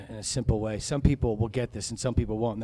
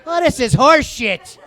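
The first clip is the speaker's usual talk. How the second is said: much higher and louder, laughing, slower; exasperated yell; 10 semitones higher, 13 dB louder, slower than usual. Retold or imitated. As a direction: blurt it like a cranky six-year-old. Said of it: "I'm like".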